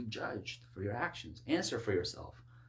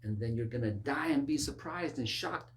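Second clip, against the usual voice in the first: usual speaking voice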